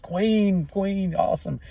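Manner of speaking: high, starstruck cheer; adoring fan cheer. Retold or imitated. Concert fans cheering for Queen, high and starstruck. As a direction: gush at the stage.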